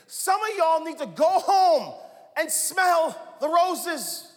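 loud, slow, preaching call; preaching shout. Acted. Preacher urging congregation to slow down, loud and slow. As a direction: drive it home to the crowd.

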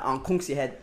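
imitated Belizean Creole threat; Belizean Creole accent. Shown as a demonstration. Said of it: "I don't have the good accent".

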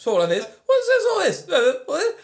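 very high, loud mock British accent; hammed-up British accent, shooting upward; John Oliver. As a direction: squawk an overblown British accent. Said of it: "who definitely overblows his British accent".

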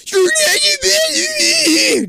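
very high, slow wailing scream. Acted. Kid brother screaming in a headlock, very high and loud. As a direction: shriek like a furious kid. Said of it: "Denny screams again".

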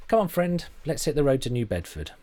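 high, bright friendly call; lightly voiced upbeat young woman; cheery American woman. A narrator's reading.

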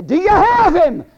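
high shouted demand from the devil; devil calling out. Acted. Devil asking the grave whether it holds Jesus, calling out. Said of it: "the devil calling out the, the grave".

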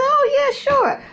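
squeaky high voice, far up her range; high-pitched, girlish sure; mock eager acceptance. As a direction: chirp yes like a flattered girl. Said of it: "I said".

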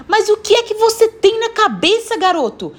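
very high, incredulous scolding; exasperated demand. Acted. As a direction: demand to know what he was thinking. Woman confronts runaway boy. (cobrança exasperada e aguda)

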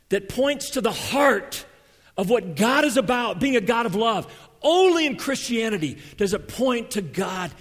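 loud, high, fervent preaching; preacher building to a peak; preaching at full force. Acted. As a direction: drive it home to the congregation.

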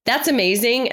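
high delighted gasp; mock delight. Host reacting to Pedro's phrase, high and delighted. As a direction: sell it as the best idea ever. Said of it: "I'm like".

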